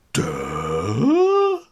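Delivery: hammed-up dopey grunt; dumb bully grunt. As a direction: blurt a dim-witted duh.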